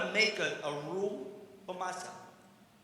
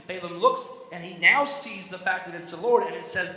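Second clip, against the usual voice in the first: ordinary delivery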